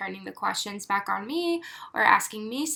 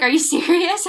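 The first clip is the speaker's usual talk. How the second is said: incredulous question; 7 semitones higher, 5 dB louder than usual. Retold or imitated. Josh laughing in disbelief.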